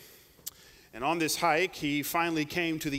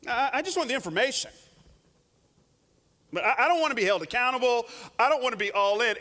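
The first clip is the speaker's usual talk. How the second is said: quick, a little higher, half-hearted whine; 5 semitones higher, faster than usual. Acted. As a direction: rattle it off like a lukewarm believer. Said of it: "too many Christians are auditing Jesus".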